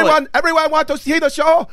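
put-on high-pitched Filipino-accented stage banter; mock Filipino accent. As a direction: imitate a Filipino singer talking between songs. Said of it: "he's Filipino when he's not".

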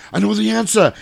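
high-pitched eager action-star impression; Arnold impression. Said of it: "He's like".

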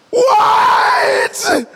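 high, drawn-out imitated scream; incredulous mock shriek. Preacher as wife answering husband's silly question, incredulous mock shriek. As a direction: shriek it like a wife in labor, outraged.

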